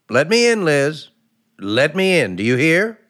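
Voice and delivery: loud, high demand through a locked door; calling through door. Real, not acted. Husband calling to his wife through the locked door, loudly. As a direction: raise your voice so she hears through the wood.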